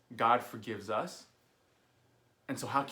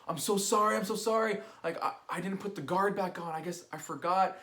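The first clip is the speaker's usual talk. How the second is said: high, louder flustered apologizing friend; panicked apology; 8 semitones higher, 4 dB louder than usual. Retold or imitated. Buddy apologizes for cutting off the sideburn, high flustered voice. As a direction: gush apologies in a hurry.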